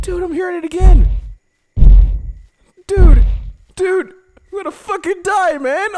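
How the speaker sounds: high, loud panicked yell. Real, not acted. Player hearing a dinosaur close in, panicked yell. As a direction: shout in real fright.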